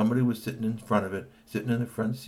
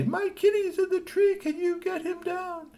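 pleading crying woman; 3 dB louder than usual. Acted. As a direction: beg through tears for the kitty. Woman's plea remembered.

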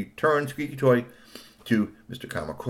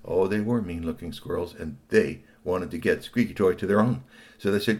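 plain reading voice

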